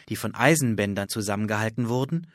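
usual speaking voice

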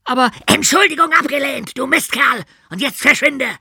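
high, loud bellow. Acted. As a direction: roar the refusal. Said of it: "brüllte ihn Kniescheibe an".

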